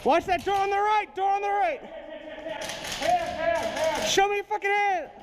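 high, urgent yelled orders; shouting commands. Real, not acted. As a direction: yell orders at the suspect.

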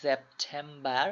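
"সেপ্টেম্বর" slow, starting on a Z like zet. Shown as a demonstration. Tutor slowly modelling German September for students. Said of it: "জেটের মতো".

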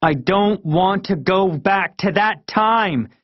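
put-on high, strained angry kid voice; rising anger. A narrator's reading. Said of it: "my voice getting louder with each word".

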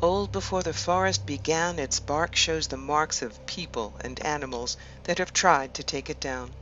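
measured meditation voice with long pauses between phrases; guided meditation. Acted. Host guiding listeners to relax and imagine an ancient wood, guided meditation. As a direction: lead them gently inward, leaving space after each phrase.

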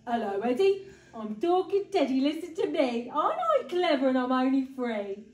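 loud, hammed-up puppet voice; big bear's put-on teddy voice; bear playing teddy. A narrator's reading. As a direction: show off as a pretend talking toy. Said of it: "crouched down behind it and said".

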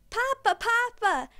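put-on high, loud child's call for his father; a little boy calling out for his Papa; excited cry. A narrator's reading. Boy greets his father in the nursery, excited high cry. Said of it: "he cried".

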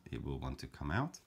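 normal talking voice

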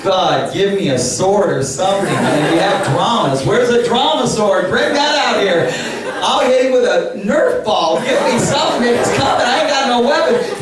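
high, loud half-laughing plea; a preacher's comic panic as the boy comes at him; mock panic. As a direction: beg for a weapon in comic alarm. Panicked prayer as Dylan approaches.

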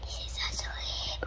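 slow hushed whisper. Real, not acted. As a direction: breathe it so she stays asleep. Kitten asleep, hushing.

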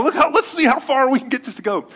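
put-on high-pitched, eager boyish dare; excited kid. Boy talking to his brothers about flinging a marshmallow, excited. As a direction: retell it with mock boyish glee.

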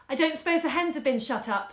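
a hoarse call after him. A narrator's reading. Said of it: "she called hoarsely after him".

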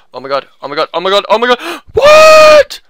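rising from normal to a high shriek; escalating gamer freak-out; mounting shock. Real, not acted. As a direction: build panic into a loud screech of disbelief. Growing shock during the fight.

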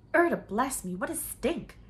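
high, loud disgusted outburst; loud exclamation. A narrator's reading. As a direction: recoil from the stench. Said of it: "the deep voice said".

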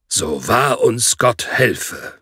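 slow, grave mock oath; exaggeratedly solemn. A narrator's reading. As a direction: ham up a solemn vow, slowly. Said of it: "verkündete er übertrieben feierlich". (übertrieben feierlich, langsam und getragen)

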